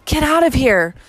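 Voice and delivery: a desperate hushed-but-high plea; begging. A narrator's reading. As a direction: beg her to flee before they come in. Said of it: "I all but begged".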